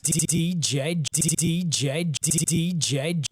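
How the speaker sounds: loud stuttered DJ tag; stutter drop. Shown as a demonstration. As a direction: stutter the opening sounds. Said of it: "you get something like this".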